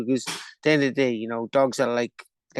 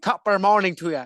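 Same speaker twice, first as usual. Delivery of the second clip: higher, louder mock Irish accent; 5 semitones higher, 4 dB louder than usual. Asked for an Australian, gives a mock Irish accent. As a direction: toss off a stage Irish accent. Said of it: "do an Australian?".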